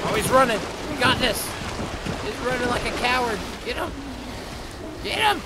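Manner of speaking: high, loud, excited yelling; excited cheering. Real, not acted. Cheering on his pet dinosaur as it chases prey, high excited yells. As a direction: hype up the dino like a sports fan.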